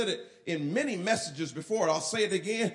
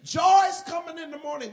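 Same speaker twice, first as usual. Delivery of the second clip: higher, louder sermon-ending flourish; mock preacher close; 8 semitones higher, 6 dB louder than usual. Preacher wrapping up the sermon, mock triumphant. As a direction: ham up the preacher's big finish. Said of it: "Normally when preachers preach that".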